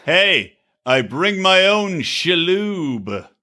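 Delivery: slow, drawn-out mock pickup line; Tony Shalhoub pickup line. Host doing an invented celebrity pickup line, mock suave. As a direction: deliver a cheesy come-on, stretching the words. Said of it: "Tony Shalhoub's pickup line".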